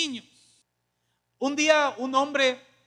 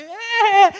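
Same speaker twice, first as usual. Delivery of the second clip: high loud whoop; mock cheer; 9 semitones higher, 8 dB louder than usual. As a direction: whoop like a delighted parent. Parent cheering child's swearing. (un grito agudo de festejo del padre)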